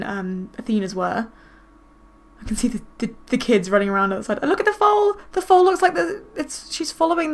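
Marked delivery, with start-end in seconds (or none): 4.4-7.3 high, eager exclamation; excited noticing. Real, not acted. Player spotting the foal outside, high and excited. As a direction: point it out in sudden delight. Spots foal following the kids.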